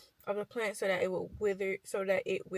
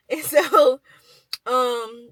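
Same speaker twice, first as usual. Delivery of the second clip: normal talking voice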